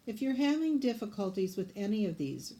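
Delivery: ordinary talk, nothing marked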